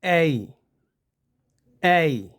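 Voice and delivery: "ए" gliding from one vowel to the next; diphthong demo. Shown as a demonstration. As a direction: glide "ए" smoothly between the two vowels. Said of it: "कैसे पढ़ेंगे इसको?".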